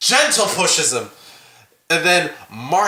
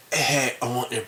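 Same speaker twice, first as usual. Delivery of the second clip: low, hushed mock seduction; creepy come-on; 8 semitones lower, 7 dB quieter than usual. Co-host replays what no best friend says, low and creepy. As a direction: sink low and soft like a creep. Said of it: "that is a straight up creep".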